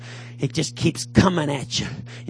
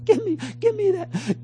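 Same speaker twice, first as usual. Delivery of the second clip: high, pleading voice; mock craving addict begging to give in; giving in; 13 semitones higher than usual. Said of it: "and you say".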